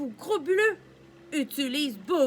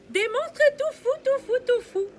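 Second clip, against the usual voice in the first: much higher and louder, giddy; crazy excited; 10 semitones higher, 3 dB louder than usual. Acted. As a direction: go wild and silly. Crazy monsters.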